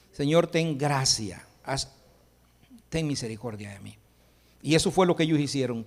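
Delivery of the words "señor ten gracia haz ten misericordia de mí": hushed acted begging for mercy; humble plea. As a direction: pray it quietly, almost to yourself.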